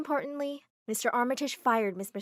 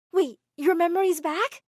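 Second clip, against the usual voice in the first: shocked question; 7 semitones higher, 9 dB louder than usual. Acted. Narrator shocked by news.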